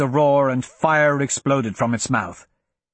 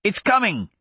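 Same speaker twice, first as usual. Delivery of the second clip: urgent warning; 7 semitones higher than usual. A narrator's reading. Hadrian warning Royce.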